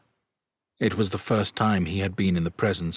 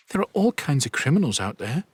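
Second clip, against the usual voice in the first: higher, anxious mother; worried; 8 semitones higher than usual. A narrator's reading. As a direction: fret about the danger, voice raised. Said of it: "sounding worried".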